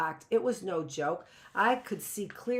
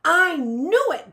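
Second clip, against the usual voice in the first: much higher and louder, triumphant; triumphant croak; 11 semitones higher, 13 dB louder, slower than usual. A narrator's reading. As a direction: gloat that you were right. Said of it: "Judith croaked in her hoarse voice".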